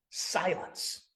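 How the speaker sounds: whispered "Silence", breathless and soft; hushed command. Acted. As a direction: hush the sea with a whisper. Jesus hushing the storm.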